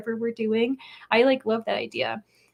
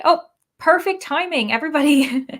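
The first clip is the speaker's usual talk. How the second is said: higher, louder announcement to all; cheerful shout-out to viewers; excited; 5 semitones higher, 6 dB louder than usual. Real, not acted. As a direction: call it out gleefully.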